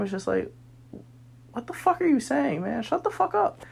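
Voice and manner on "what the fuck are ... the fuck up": exasperated outburst. Retold or imitated. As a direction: pitch jumps much higher, mock fed-up snap. Exasperated inner reaction to friends.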